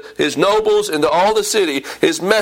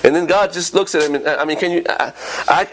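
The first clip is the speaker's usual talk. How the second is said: ordinary delivery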